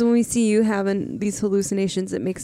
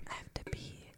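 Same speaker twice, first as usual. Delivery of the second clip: whispering; 23 dB quieter than usual. Real, not acted. Whispered aside mid-chat.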